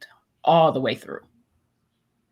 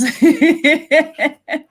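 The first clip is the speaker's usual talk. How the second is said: loud high burst of laughter; real hearty laugh; big laugh; 9 semitones higher, 6 dB louder than usual. Real, not acted. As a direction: crack up at your own joke. Laughing at own joke.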